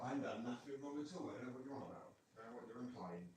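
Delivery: high mock-innocent protest voice for the dog; voicing the dog. Owner voicing the dachshund denying it was naughty, high mock protest. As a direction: give the naughty dog a high, put-upon voice of denial. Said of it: "Screaming like".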